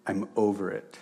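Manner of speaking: heartfelt spoken prayer, softening. Real, not acted. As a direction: pray aloud, sincere and hushed.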